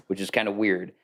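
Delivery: normal talking voice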